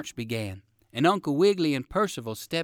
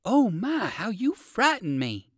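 high exclamation, slowing at the end; startled relief; 8 semitones higher than usual. A narrator's reading. Rabbit tells the dog of his fright, startled.

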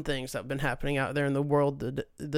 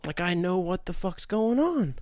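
ordinary talk, nothing marked

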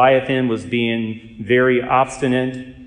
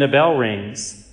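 normal talking voice

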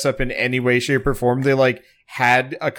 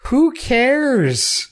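dismissive high "who cares"; 11 semitones higher than usual. Retold or imitated. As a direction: toss it off with disdain. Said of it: "I'm like".

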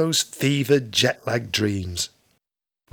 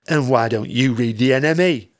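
grumpy reply; 3 dB louder than usual. Acted. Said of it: "my grumpy reply".

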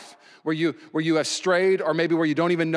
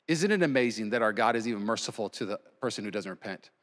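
normal talking voice

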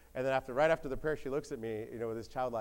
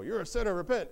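higher, louder mock rebuke; escalated accusation; 8 semitones higher, 5 dB louder than usual. Job's friends insist he must repent, raised-voice accusation. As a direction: hammer the accusation home, voice raised.

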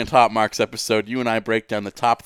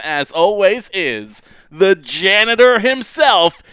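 loud, drawn-out announcer intro; ring announcer; 5 semitones higher, 6 dB louder than usual. Acted. Big podcast intro, announcer voice.

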